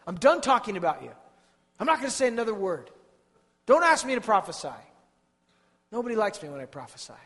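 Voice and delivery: loud, high, fed-up outburst at God; the weary prophet throwing up his hands, loud; exasperated prophet. Acted. Prophet complaining loudly to God, fed-up outburst. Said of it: "That's what he said to the Lord".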